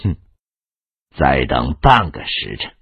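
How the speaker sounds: low, scornful sneer opening on a scoffing hmph; cold sneer. A narrator's reading. Young commander mocking the enemy camp before battle, cold sneer. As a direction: sneer coldly in a low, softened voice. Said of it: "冷笑道".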